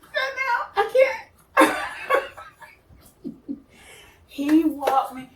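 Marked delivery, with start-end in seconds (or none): 0.0-3.6 high, laughing squeal of disbelief; giddy laughing disbelief. Retold or imitated. Reacting to the handsome Marine, high giggling disbelief. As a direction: melt into giggles, can't handle it.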